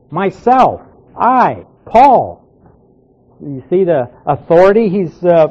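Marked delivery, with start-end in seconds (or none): 0.1-2.4 "Myself", "I" and "Paul" each hit separately, pitch up on "Paul"; three emphatic beats on "Myself", "I", "Paul". Shown as a demonstration. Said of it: "very emphatic here".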